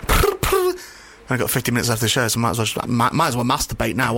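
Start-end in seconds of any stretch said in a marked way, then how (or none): 0.1-0.7 high trilled brr-brr like a ringing phone; phone ring imitation. Host fakes the sex line ringing, high trilled brr. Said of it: "just ringing".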